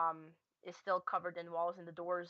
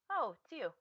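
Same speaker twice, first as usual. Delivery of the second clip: lightly voiced cat, pitched higher; cat in the distance; 6 semitones higher than usual. Cat to the mouse it knows, voiced a little higher. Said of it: "hear a cat in the distance say".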